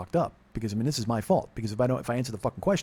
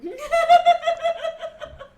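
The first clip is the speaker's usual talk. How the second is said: loud high burst of laughter; big laugh; 5 dB louder than usual. Real, not acted. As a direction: laugh hard at yourself.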